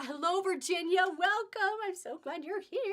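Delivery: high, loud, gushing welcome; excited greeting. Real, not acted. Host welcomes Virginia as she joins the live sale, excited greeting. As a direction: sing out a delighted hello, pitch climbing.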